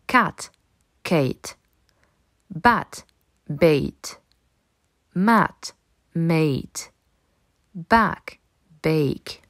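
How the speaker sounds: "Cat" short a, "Kate" long ei, each word clearly apart; minimal pairs demo. Shown as a demonstration. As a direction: contrast "Bat" and "bait", "Mat" and "mate", "Back" and "bake". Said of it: "Écoute bien". (prononciation contrastée de "Cat" et "Kate")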